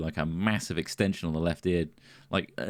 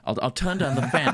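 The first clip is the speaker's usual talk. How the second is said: normal talking voice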